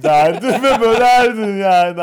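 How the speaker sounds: high, loud burst over laughter; loud, amused exclamation. Real, not acted. Co-host joking that a sultan would just split the city, loud and amused. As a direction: raise pitch and volume, half laughing.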